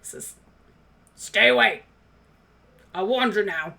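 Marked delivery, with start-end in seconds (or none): 1.1-3.7 gruff dwarf. Acted. Dwarf warns again.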